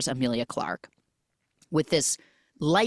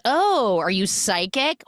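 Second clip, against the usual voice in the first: higher, louder retold "oh"; mock surprise; 5 semitones higher, 3 dB louder than usual. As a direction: playfully act out being impressed. Retold flirty bar reply.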